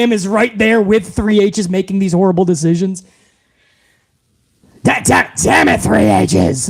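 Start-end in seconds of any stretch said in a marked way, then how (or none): 4.8-6.7 put-on much lower, gruff old chairman; deep boss impression. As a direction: growl it like a grumpy old boss.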